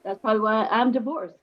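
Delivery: normal talking voice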